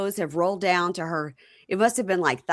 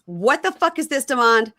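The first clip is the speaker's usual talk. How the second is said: higher, louder retold outburst; retold angry demand; 6 semitones higher, 5 dB louder than usual. Woman confronting Demond over a surprise guest, retold angrily. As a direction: escalate into an indignant mock demand.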